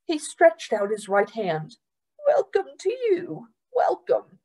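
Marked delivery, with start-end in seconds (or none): 2.3-4.4 high, lightly voiced old man; Mister Randolph's welcome. Mister Randolph welcoming the new girl, kindly. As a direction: greet her kindly.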